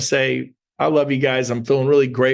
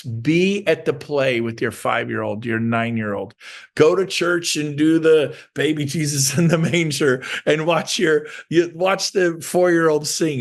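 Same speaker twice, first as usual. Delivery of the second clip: ordinary delivery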